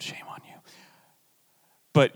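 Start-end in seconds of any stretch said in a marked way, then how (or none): 0.0-0.5 barely voiced mock scolding; whispered scold. Real, not acted. Pastor whispering mock shame at greedy listeners. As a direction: breathe it out as a playful rebuke. Teasing aside.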